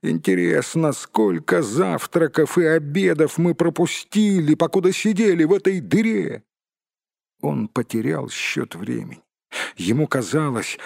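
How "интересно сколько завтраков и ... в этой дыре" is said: high, complaining hobbit voice, climbing as it goes; exasperated hobbit. Acted. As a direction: grumble on a high pitch as a hungry, put-upon hobbit.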